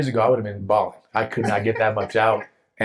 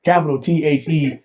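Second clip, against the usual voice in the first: "T H E" spelled out high and loud; "Capital T H E" proclaimed letter by letter; emphatic spelling; 7 semitones higher, 6 dB louder than usual. Real, not acted. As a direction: punch each letter of "T H E". Spelling out for emphasis, others laugh.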